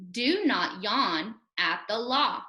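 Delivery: slow, evenly spaced words; deliberate choral-reading delivery; read-along pace. Shown as a demonstration. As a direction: lead learners slowly through the sentence. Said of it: "Try it with me".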